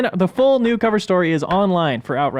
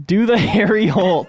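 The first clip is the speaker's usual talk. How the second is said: Australian accent; 4 semitones higher, 5 dB louder, slower than usual. Shown as a demonstration. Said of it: "I guess I do".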